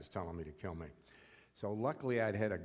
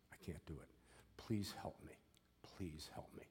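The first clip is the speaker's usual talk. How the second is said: breathy whispered begging; whispered plea; 2 semitones lower, 8 dB quieter than usual. Retold or imitated. Prisoner on his knees whispering to God for help. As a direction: whisper it as a desperate prayer. Desperate prayer in prison cell.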